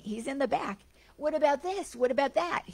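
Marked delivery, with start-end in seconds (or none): 1.2-2.6 high, badgering questions; arguing heckler. Heckler badgering the Buddha with questions, higher nagging taunt. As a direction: needle him again and again.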